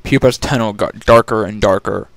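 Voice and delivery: ordinary delivery, nothing marked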